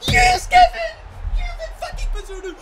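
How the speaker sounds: very high, loud screech of joy; shrieking celebration, easing off in volume; ecstatic scream. Real, not acted. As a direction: scream the keeper's praise in real elation. Keeper saves a penalty.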